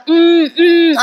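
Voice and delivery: high muffled mm-mm as if gagged by a glass; imitated choking on water; muffled mm sounds. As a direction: hum high through a pressed mouth. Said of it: "असं करत असते".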